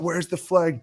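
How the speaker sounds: high-pitched mock whine; complaining tight end. Tight end appealing for a flag after a drop, mock indignant. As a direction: mimic an indignant player pleading his case. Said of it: "he was over there like".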